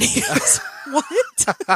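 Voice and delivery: laughing through the words; can barely get the quote out for laughing; giggling while retelling his reaction. Real, not acted. Laughing while quoting him.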